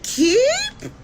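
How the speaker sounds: very high shocked "Keep?"; incredulous squeak. Retold or imitated. Girlfriend echoing him in total disbelief, squeaky.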